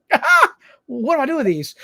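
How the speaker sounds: mock bewildered, laughing it off; baffled laugh. Host holding eggs on stage, mock baffled laugh. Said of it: "And I was like".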